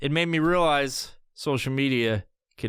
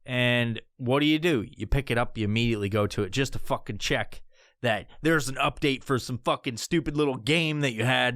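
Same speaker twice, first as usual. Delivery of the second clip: normal talking voice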